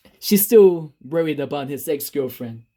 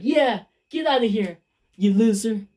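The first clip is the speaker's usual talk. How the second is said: high, sneering brush-off; mocking taunt; 7 semitones higher than usual. Ex sneering at Donna as she leaves, high taunt. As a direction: shoo her away with scorn. [name] mocks departing Donna.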